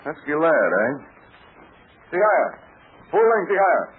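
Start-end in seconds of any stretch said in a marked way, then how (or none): 2.2-3.8 mock Chinese words. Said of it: "you could speak Chinese".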